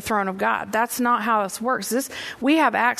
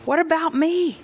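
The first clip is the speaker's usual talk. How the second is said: pleading Jesus; 6 semitones higher than usual. Acted. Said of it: "he's saying".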